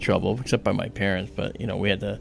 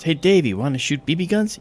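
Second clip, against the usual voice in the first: raised, eager-sounding mock invitation; phone caller; 7 semitones higher, 5 dB louder than usual. Boy phones his friend to shoot BB guns, upbeat caller. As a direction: re-enact an upbeat call to a friend. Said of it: "the phone call starts like this".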